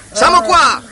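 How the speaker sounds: high hammed-up radio yell; shouting into radio. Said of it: "Alberto dice per radio". (urlo alla radio, acuto)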